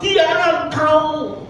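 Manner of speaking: high, slow mock scream of contempt; angry woman shouting down the old man, high hammed-up yell. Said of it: "Dan berteriak".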